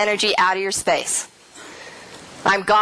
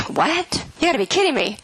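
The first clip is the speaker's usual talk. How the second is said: high-pitched disbelief, retold; acted-out astonished reaction; incredulous; 6 semitones higher than usual. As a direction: recount a stunned comeback in a higher voice. Reacts to son's startling news.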